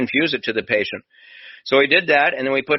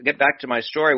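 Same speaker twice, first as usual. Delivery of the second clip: ordinary talk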